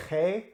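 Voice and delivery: G said "khay" with throaty friction; name the G from the back of the mouth; guttural Dutch G, air pushed over the palate; Dutch letter G. Shown as a demonstration. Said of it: "the letter is pronounced".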